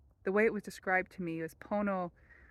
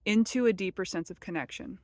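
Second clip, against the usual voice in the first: normal talking voice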